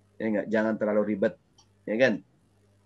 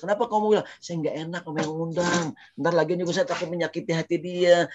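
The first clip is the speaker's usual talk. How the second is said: timid guest; 4 semitones higher than usual. Acted. Acted timid guest dialogue.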